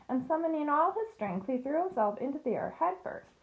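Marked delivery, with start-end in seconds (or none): none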